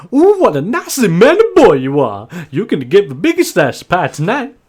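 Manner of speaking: high, loud, gushing farm wife; Maud. Acted. Farm wife fussing over a young guest, high and gushing. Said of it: "gives you a shake and just go".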